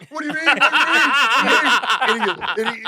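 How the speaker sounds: mock-panicked replacement driver; freaked out. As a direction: repeat it in comic alarm. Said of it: "He's all freaked out".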